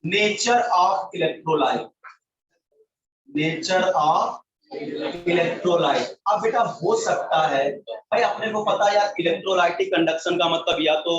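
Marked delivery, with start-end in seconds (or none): 0.0-6.1 very slow, word-by-word dictation. Real, not acted. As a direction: draw each word out for note-takers. Dictates first point, repeating it. (बहुत धीरे, शब्द-शब्द लिखवाते हुए)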